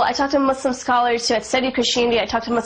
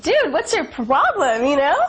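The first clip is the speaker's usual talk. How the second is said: indignant, higher, slower challenge with swooping stress; retold indignation; 4 semitones higher than usual. Student confronting classmate who laughed at the video, retold indignation. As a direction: call out a friend, lightly replayed.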